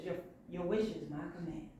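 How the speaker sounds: low, quick, hushed reply; soft wish-granting. Acted. Princess Fish granting beefy guards, soft and low. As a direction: toss it off low and quiet.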